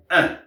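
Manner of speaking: "un" as a short nasal vowel, no N; French nasal vowel. Shown as a demonstration. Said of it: "Nasalisé". (« un » nasalisé à la française)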